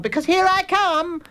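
high, girl's mock-fierce threat; high-pitched play-fight menace; playful snarl. A narrator's reading. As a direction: snarl like a kid playing pirate. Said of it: "she snarled".

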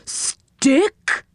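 high questioning pipe; high comical puzzlement; puzzled character voice. Acted. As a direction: query the misheard word, pitched high. Saucepan man mishears again.

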